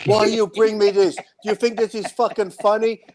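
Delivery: hamming up a furious bodybuilder; mock angry outburst. Retelling Nasser snapping at friends over cake, mock angry. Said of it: "Did he flip out?".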